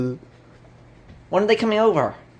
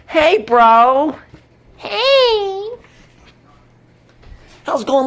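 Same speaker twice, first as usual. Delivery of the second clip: usual speaking voice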